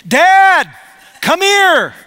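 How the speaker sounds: high, loud call across the house; child calling out. Retold or imitated. Son calling his dad from across the house, a high loud call. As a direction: yell for dad from the other room.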